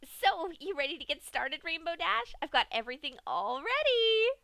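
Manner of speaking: high, loud, eager voice; eager Pinkie. A narrator's reading. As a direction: rush it out, bursting to start. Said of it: "the pink pony said".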